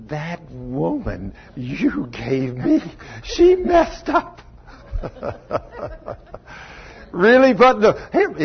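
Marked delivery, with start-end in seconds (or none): 0.1-4.4 whiny blaming Adam. Retold or imitated. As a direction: drag out the blame like a caught child. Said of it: "He said".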